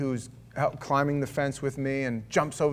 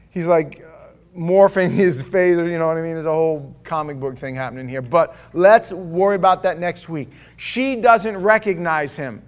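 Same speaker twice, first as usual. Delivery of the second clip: ordinary talk, nothing marked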